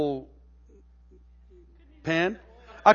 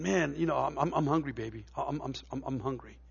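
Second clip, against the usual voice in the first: soft, whiny mock complaint; hungry plea to wife; 5 semitones lower, 9 dB quieter than usual. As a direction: plead quietly like a hungry husband. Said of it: "I tell Kathleen".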